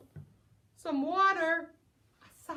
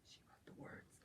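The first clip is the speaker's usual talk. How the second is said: soft whispered aside; 25 dB quieter, faster than usual. Pretend. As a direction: whisper it like a secret. Whispered aside while reading.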